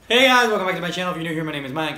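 high, loud and fast channel greeting; hyped intro. Acted. Vlogger greets his viewers, high, loud, fast. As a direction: burst in like the show just started.

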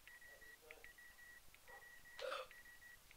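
long whispered gasp of alarm; drawn-out breathy gasp. Real, not acted. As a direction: draw a long shocked breath. Mob barks at him.

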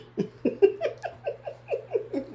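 squealing high laugh; high shrieking laugh. Real, not acted. Vlogger laughing at her own popcorn greed, shrieking laugh.

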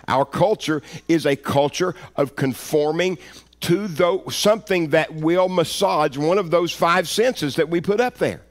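loud, high, driving sermon delivery; forceful pulpit proclamation; full-force preaching. Real, not acted. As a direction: preach it hard, punching key words.

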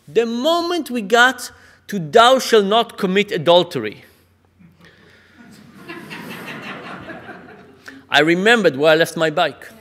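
mock-breathless delight; excited congregant. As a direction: burst out with the big news to the rabbi. Man excitedly reports joke's punchline.

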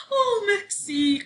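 high, relieved-sounding drawn-out cry; high-pitched exclamation. A narrator's reading. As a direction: cry out your thanks, high and slow. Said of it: "cria Sophie".

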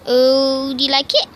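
ordinary delivery

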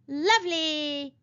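slow, savoured happy cry; delighted. A narrator's reading. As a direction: pig thrilled at the wedding.

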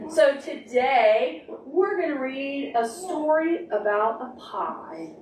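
slow, drawn-out announcement; slow storytime intro. Real, not acted. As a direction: stretch it out to build interest. Teacher introducing story time.

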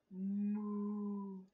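low mooing cow; lightly voiced cow. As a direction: moo like a cow. Cow sound clue.

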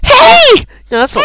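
very high, loud squeaky elf hail; high-pitched elf. Acted. Trying out an elf voice for friend, really high-pitched. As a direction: shoot the voice right up like an elf. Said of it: "really high pitched".

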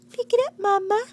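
put-on loud demanding baby voice. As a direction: demand it like a bossy toddler. Voicing baby's demand.

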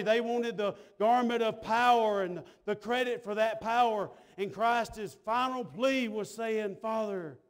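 steady, driving pulpit delivery; impassioned sermon cadence; preaching. Real, not acted. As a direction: preach it out to the congregation. Preaches with force.

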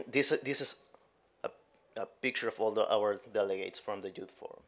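ordinary delivery

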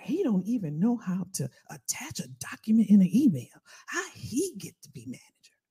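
hushed, whispery gossip; secretive whispered griping; behind-his-back whisper. Retold or imitated. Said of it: "I talked about him behind his back".

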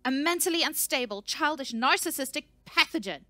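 high, loud, furious tirade; screaming. A narrator's reading. Researcher screams insults at the scout, enraged. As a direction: scream each insult at him. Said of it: "she screamed".

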